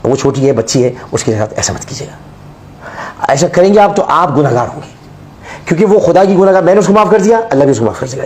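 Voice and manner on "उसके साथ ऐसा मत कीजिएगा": slow, hushed whisper of a plea; pleading whisper. Real, not acted. Begging the audience to spare the girl, whispered. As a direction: beg them softly, almost breathless.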